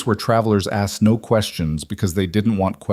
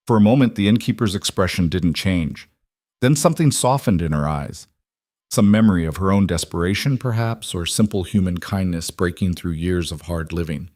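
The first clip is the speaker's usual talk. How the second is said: plain reading voice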